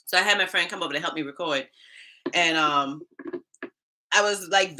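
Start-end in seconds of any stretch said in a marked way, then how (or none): none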